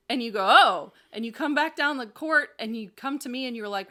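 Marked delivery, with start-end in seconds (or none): 0.5-0.9 sudden realization. Retold or imitated. Retold realization.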